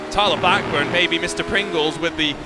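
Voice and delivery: energetic commentator call over the action; live race play-by-play. Acted. Commentator calling Blackburn's lap to viewers, energetic play-by-play. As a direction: narrate each corner as it happens.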